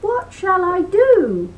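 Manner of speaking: high, loud fretful plea; dismayed Niall. Acted. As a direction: whine it like a worried big lad.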